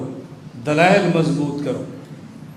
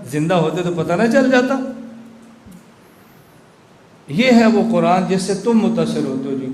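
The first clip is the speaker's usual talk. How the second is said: ordinary delivery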